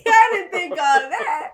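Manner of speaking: high, loud denial through real laughter; slow, helplessly amused objection; laughing protest. Real, not acted. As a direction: protest while cracking up.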